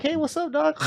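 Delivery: passer-by greeting a dog right beside him. Retold or imitated. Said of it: "And you're like".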